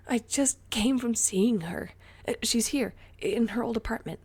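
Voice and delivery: breathless, high and loud; out of breath, pushing words out; panting. A narrator's reading. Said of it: "panted Ray".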